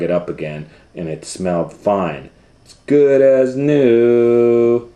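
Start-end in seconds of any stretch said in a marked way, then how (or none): 2.6-4.9 slow, high and loud; beaming little boy; proud child. Acted. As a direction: stretch it out delighted. Owen proud of restored blanket.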